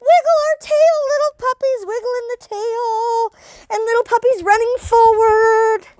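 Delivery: high, bouncy cheering of little puppies; playful kids' yoga voice. Acted.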